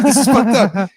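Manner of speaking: high, loud freaked-out cry; loud appalled yell on the edge of laughter; mock horror. Voicing a shocked viewer of the movie, mock horror.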